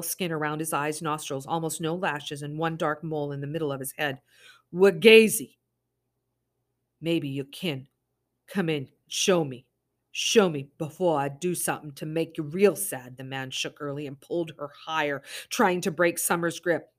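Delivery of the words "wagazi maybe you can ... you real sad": menacing thug. Acted. Thug threatening Summer.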